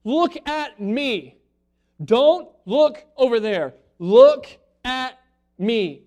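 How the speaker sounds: put-on a parent insisting the child look at them; firm parent. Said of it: "kinda like a, a parent does to his kid".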